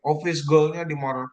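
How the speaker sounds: usual speaking voice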